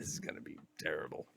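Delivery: quick, hushed whisper; whispered aside. Real, not acted. Player dreading what comes next, whispered. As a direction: whisper it fast under your breath.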